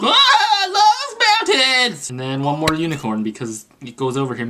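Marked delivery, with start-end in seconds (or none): 0.0-2.1 very high, loud drawn-out squeal; overexcited cartoon character. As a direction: squeal with hammed-up delight. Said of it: "and he's like".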